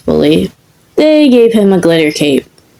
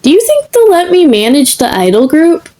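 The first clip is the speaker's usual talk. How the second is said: high, bright eager question; beaming; 8 semitones higher than usual. Acted. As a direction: pitch way up with excitement. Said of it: "Izuku beamed".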